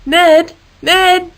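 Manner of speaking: high, loud name call. Acted. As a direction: call up to the wire. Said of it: "Harold called out to him".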